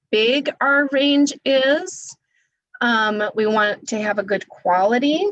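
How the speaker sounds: normal talking voice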